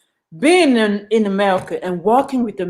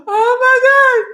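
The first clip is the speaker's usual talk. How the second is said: much higher, louder laughing cry; laughing disbelief; 6 dB louder than usual. Real, not acted. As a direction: exclaim through laughter. Laughing disbelief at own story.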